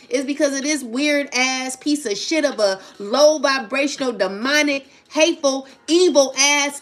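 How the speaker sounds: loud, building angry tirade, piling up insults; furious rant. Real, not acted. Mother ranting at her kids' father, furious and loud. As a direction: let the anger boil over word by word. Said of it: "I'm really getting pissed".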